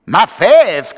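put-on high, loud Creole French accent; French-accented protest; tiny Creole's cry. A narrator's reading. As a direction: cry out as a small indignant Creole. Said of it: "cried a tiny Creole".

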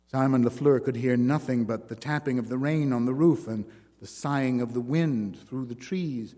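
ordinary delivery

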